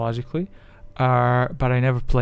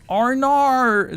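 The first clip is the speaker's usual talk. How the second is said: put-on Australian accent; 11 semitones higher, 4 dB louder than usual. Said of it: "I can't clock is the Australian".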